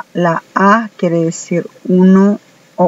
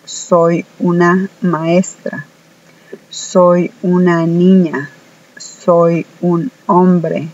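slow example phrases; slower than usual. Shown as a demonstration. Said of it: "tenemos que decir".

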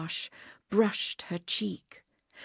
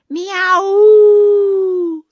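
long high whistle blast, very loud; imitated steamer whistle; 12 semitones higher, 17 dB louder than usual. Steamer whistle blaring over the departing passengers. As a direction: blare it like a ship's whistle. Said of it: "The second whistle blared".

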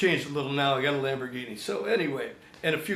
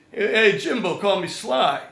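hearty greeting; 5 semitones higher, 6 dB louder than usual. Retold or imitated. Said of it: "Is this really Sylvester Stallone?".

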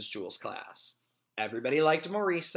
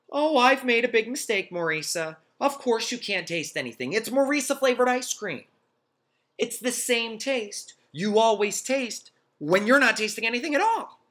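excited teacher voice; 10 semitones higher, 6 dB louder than usual. Acted. Teacher realizes her mistake.

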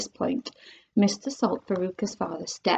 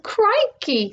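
surprised exclaim; 10 semitones higher than usual. Acted. Mike TV exclaiming.

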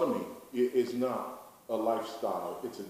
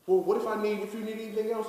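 high, louder imitation of a worried questioner; mimicked objection; 11 semitones higher, 5 dB louder, faster than usual. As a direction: blurt it out as a higher-pitched mock objection. Voicing an objection to the narrative.

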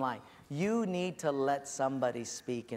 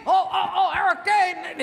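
high, loud flustered yelling; hammed-up alarm, all in a fluster; mock panic; 9 dB louder than usual. Firing squad reacting to storm warning, mock panic.

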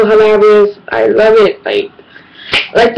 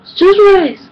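high, slow whining complaint; exasperated teen whine at mom; whiny kid; 8 semitones higher, slower than usual. Retold or imitated. Whining at mom over rice.

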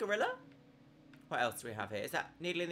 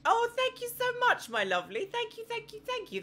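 much higher, bubbly thank-yous; gushing thanks; 4 dB louder than usual. Real, not acted. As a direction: squeal your thanks to a kind viewer.

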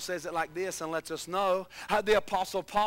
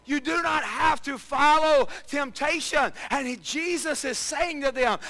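loud, higher preaching; 4 semitones higher, 5 dB louder than usual. Acted. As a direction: push the point hard. Preaching at full force.

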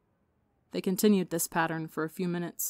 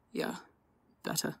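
rough mutter; 6 dB quieter than usual. A narrator's reading. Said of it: "Wilbur muttered, his voice rough".

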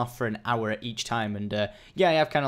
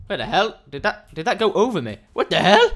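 raised, louder and louder astonishment; startled disbelief; 7 semitones higher, 8 dB louder than usual. Real, not acted. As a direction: build to a loud, baffled outburst. Fish flew over the player.

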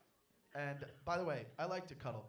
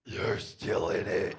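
low, slow eerie voice; spooky voice; 9 semitones lower, 10 dB louder, much slower than usual. Retold or imitated. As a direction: say it slow and ominous. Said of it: "I was like".

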